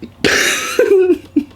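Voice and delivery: high, loud burst of held-in laughter; fail to hold the laugh in; stifled laugh. Retold or imitated. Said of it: "trying so fucking hard not to laugh".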